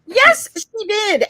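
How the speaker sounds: high, loud, drawn-out protest; indignant contradiction. Real, not acted. Disputing the fundraiser claim.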